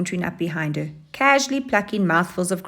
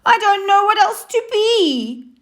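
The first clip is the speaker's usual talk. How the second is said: high, louder wailing cry; wailed; 5 dB louder than usual. Acted. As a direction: wail it out in despair. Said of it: "wailed Hyena".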